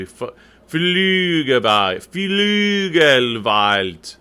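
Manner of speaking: "Flügel weilt" very slow, each l held clear; all L's sounded. Shown as a demonstration. Coach drilling the choir, "Flügel weilt" with lingering L's. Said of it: "All those L's in there".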